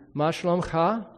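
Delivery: "Ma shalom cha?" in Hebrew, the ch of "cha" a throaty kh, rising at the end; a pastor shows the congregation the Hebrew greeting, rising question. Shown as a demonstration. מה שלומך, שאלה עולה. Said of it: "this is how you would say it".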